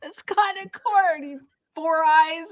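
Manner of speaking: high, drawn-out mock-desperate protest; tortured captive. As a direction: plead and insult from the rack, hammed up. Acts out captive insulting his interrogators.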